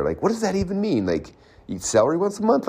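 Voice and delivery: high-pitched incredulous questioning. Acted. Coach picking apart a vague health goal, incredulous.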